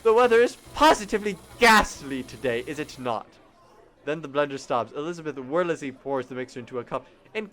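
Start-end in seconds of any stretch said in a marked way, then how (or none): 0.0-3.3 mock her with a toff British accent; mock posh British. Customer mocks Elizabeth's British slip in a hammy English accent. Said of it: "talk like British".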